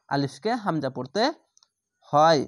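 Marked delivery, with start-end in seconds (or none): none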